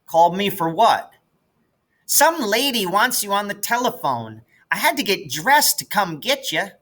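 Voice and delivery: put-on a small girl peeking out of bed; little sister's voice. Little sister Maybelle wakes and asks.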